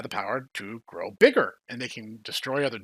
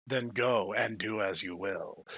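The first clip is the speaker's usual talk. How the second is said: shady tempter; 6 semitones lower, 10 dB quieter, slower than usual. Retold or imitated. Shady suspect making an offer.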